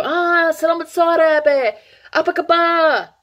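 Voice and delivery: high, cheery greeting to a local; bright Indonesian greeting. Pretend. Tourist greeting a local, high and cheery. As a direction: sing out hello in Indonesian.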